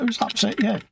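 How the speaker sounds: high-pitched, indignant retort; retold comeback, pitched well up; touchy challenge. Streamer replying to Fox's War and Peace response, touchy.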